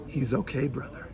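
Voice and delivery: soft calm reassurance; reassuring. Retold or imitated. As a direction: settle the young man gently. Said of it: "so reassuringly".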